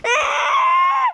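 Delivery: high shriek with nervous laugh; shrieking scream. Real, not acted. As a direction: scream half laughing in panic. Mannequin moves.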